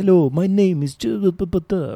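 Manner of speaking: high, hammed-up Hungarian accent; mock Hungarian accent. Mocking himself as an immigrant kid, in a hammed-up Hungarian accent. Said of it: "a Hungarian accent".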